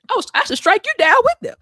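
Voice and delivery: high, loud, fast mock threat; mock furious God. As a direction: play an exasperated God ready to smite. Voicing God's threat, laughing.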